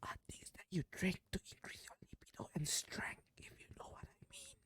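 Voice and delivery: hushed suggestive whisper. Real, not acted. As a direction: whisper it conspiratorially. Whispered innuendo aside.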